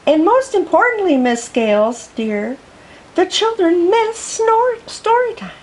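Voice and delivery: high, sugary little teacher voice; sweet kindergarten teacher. Acted. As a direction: coax her gently like a sweet teacher. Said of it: "sweet Miss Lemon, the kindergarten teacher".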